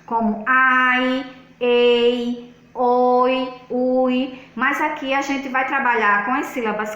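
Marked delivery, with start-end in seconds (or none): 0.5-4.4 "ai, ei, oi, ui" drawn out on a level pitch; vowel-pair drill. Shown as a demonstration. Teacher showing vowel clusters, sounding out "ai, ei, oi, ui" slowly. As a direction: hold each of "ai, ei, oi, ui" long and separate. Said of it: "que são encontros de duas vogais".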